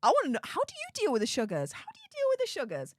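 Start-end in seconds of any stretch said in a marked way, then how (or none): 1.7-2.9 much higher, fast, sing-song question; playful high repeat. Real, not acted. Streamer repeats his question to chat, playful high sing-song. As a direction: repeat it way up high, teasing and curious.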